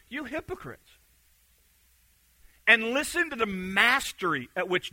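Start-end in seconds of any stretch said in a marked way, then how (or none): 0.1-1.0 much softer cutting rebuke; hushed rebuke. Retold or imitated. Jesus confronts the synagogue crowd, hushed and cutting. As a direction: let it fall hard but quiet. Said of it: "stands up and goes".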